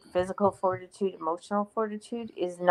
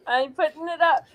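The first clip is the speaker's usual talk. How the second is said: laughing; 11 semitones higher, 9 dB louder than usual. Real, not acted. Laughing while posting comment.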